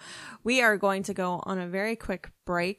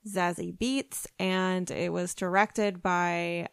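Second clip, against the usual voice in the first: normal talking voice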